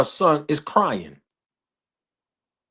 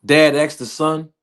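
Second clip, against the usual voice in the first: normal talking voice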